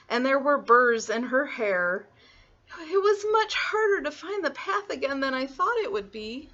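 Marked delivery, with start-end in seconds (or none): 2.8-6.5 put-on high, mildly put-out child; complaining little girl. Little Red back from the tangled woods, high complaint. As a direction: grumble in a small girl's voice.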